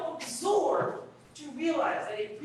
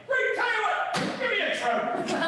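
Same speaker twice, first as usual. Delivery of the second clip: high-pitched, eager punchline voice; joke bear's comeback; 11 semitones higher, 3 dB louder than usual. Acted. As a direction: pipe up high as the bear in the joke.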